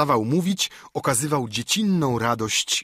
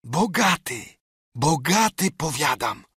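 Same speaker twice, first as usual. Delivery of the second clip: a mild fervent repeat, higher; fervent insistence; 4 semitones higher than usual. A narrator's reading. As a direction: insist it eagerly. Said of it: "powtórzył gorąco swe zapewnienie". (lekko żarliwe zapewnienie, wyżej)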